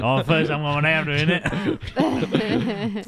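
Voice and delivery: hammed-up Australian accent, rising into a laugh. Teased for sounding Australian, plays up the mock Australian accent. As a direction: lean into a mock Australian twang. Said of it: "why are you Australian?".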